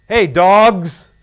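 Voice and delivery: loud, high call of street greeting; street slang greeting. Retold or imitated. Preacher imitating a big-city street greeting, loud. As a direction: holler it like a city buddy. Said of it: "the phrase is".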